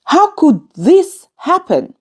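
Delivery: high-pitched astonished question; surprised disbelief. Shown as a demonstration. Teacher showing learners surprise with question marks, disbelieving. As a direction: ask it in disbelief, pitch jumping up. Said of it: "surprise or disbelief".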